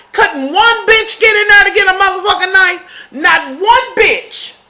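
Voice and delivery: high, exasperated disbelief, dragging out not one bitch; exasperated rant. Real, not acted. Ranting at the show's victims, exasperated and high. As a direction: scold the victims for not fighting back.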